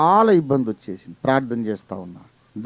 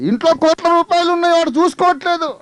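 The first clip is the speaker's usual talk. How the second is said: high, loud exclaiming preacher; impassioned preaching; 6 dB louder than usual. Acted. Preacher cries out to the church, high and loud. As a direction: cry it out in disbelief.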